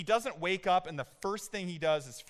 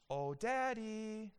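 higher, softer, very slow little voice; soft toddler voice; 5 semitones higher, 6 dB quieter, much slower than usual. Retold or imitated. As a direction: coo it gently like a trusting toddler. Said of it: "little high-pitched squeaky voice".

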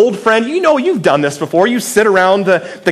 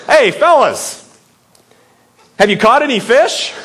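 bright teasing call to the fishermen, pitched up; playful teasing call; 6 semitones higher than usual. Retold or imitated. Jesus teasing his fishing buddies from the beach, playful call. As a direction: call out like a friend playing a joke. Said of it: "read the story with a little bit of humor".

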